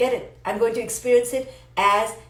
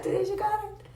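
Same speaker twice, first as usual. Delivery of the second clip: airy aside; 11 semitones higher, 8 dB quieter than usual. Real, not acted. Playful aside, breathy.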